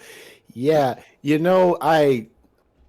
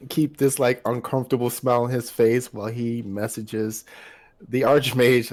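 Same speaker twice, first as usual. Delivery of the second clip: normal talking voice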